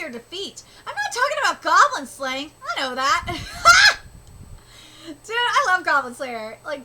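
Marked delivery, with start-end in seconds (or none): 3.3-4.6 high, loud shout of laughter; delighted laugh. Real, not acted. Reader laughing aloud at the scene, high and loud. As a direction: burst out laughing, real.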